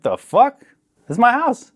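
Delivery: loud, high-pitched disbelief; incredulous retort. Retold or imitated. Husband walking in on his wife, loudly incredulous. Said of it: "And I was like".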